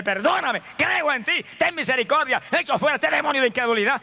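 clamor agudo de predicador; grito de súplica. Shown as a demonstration. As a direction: grita como quien pide perdón con fe. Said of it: "salga él con un grito y dígale". (súplica gritada con voz aguda)

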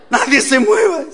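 high, loud panicky order; tense novice driver. Acted. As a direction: freeze the whole car, comically tense. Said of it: "Y va tensa allí".

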